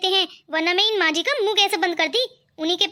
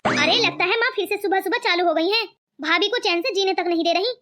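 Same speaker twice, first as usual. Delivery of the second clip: usual speaking voice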